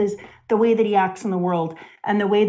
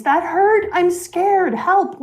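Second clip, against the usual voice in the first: higher, half-acted child's cry for help; mock scared scream; 9 semitones higher, slower than usual. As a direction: imitate a terrified little boy yelling, not full force. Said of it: "he screams".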